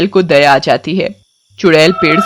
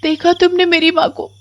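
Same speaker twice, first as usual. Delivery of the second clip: put-on high child voice, softer and aggrieved; hurt little girl; 9 semitones higher, 4 dB quieter than usual. Komal tells the witch how her mother shuts her out. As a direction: complain quietly like a wronged child.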